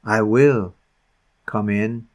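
soft, gentle promise; kindly. Acted. Christ refusing to be put away, soft and kind. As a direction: say it kindly and quietly. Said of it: "he kindly says".

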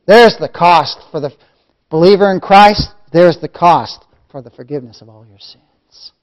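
loud sermon proclamation; emphatic preaching. Real, not acted. Preacher telling believers the cost of forgiveness, loud emphatic preaching. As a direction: declare the cost with force, then ease off.

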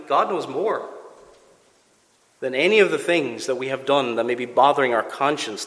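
ordinary talk

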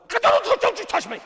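high loud shout as the sick wife; preacher voicing his sick wife refusing his touch, loud shout; wife snapping back. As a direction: bark it like she can't bear being touched. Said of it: "I said".

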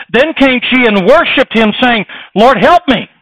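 loud, high, ringing sermon delivery; full-force preaching. Acted. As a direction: preach it out to the whole congregation.

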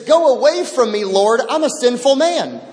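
anguished cry. Retold or imitated. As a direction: cry out as Peter fallen on his knees. Peter's confession acted out.

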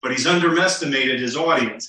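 ordinary delivery

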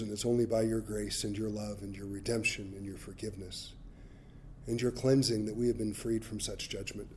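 hushed pastoral prayer. Real, not acted. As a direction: pray quietly to close the service. Closing prayer.

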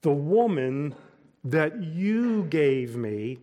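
"you" pointed, slowly, with a rise; accusing back talk. Shown as a demonstration. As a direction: blame God through the word "you". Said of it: "the context here would determine the inflection".

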